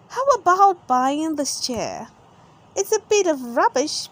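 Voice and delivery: lightly voiced saleswoman; Miss Rabbit voice. Miss Rabbit voiced selling chair.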